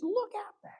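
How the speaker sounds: high, soft, insistent voice; incredulous urging. Retold or imitated. Friend pointing the violinist to the audience, high and insistent.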